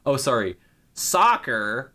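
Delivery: lightly mocked American accent; bad American accent. Streamer mocks Americans correcting football with a bad American accent. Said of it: "a really bad American accent".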